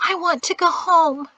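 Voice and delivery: high, pleading whimper. A narrator's reading. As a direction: whimper it like a homesick girl. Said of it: "she whimpered".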